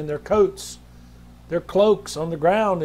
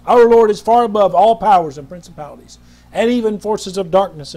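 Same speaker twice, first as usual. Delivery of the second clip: louder, emphatic declaration; preaching swell; 6 dB louder than usual. Real, not acted. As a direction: drive it home. Preaching at full force.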